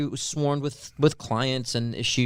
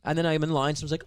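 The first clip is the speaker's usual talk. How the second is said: ordinary talk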